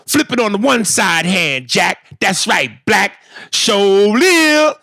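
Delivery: high, jive-talking table banter; talking with flair. Retold or imitated. Craps players trash-talking at the table, with flair. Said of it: "we talk with a flair".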